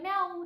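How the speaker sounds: high playful meow; a light kitten mew; cat meow imitation. Shown as a demonstration. Teacher meows again to show the cat. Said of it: "ऐसे बोलती है".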